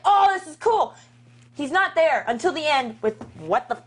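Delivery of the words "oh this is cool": excited. Retold or imitated. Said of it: "I was like".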